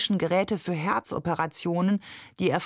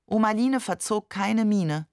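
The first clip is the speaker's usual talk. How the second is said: ordinary delivery, nothing marked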